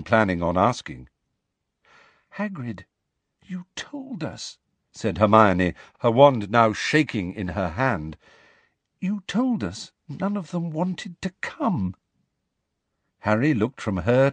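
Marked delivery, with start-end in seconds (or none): 2.4-4.6 soft, high frightened protest; fearful hush. A narrator's reading. Terrified Hermione, wand shaking. 9.0-12.0 high, soft, anxious protest; quiet, high-pitched dismay; frightened reproach. A narrator's reading. Frightened Hermione reproaching Hagrid.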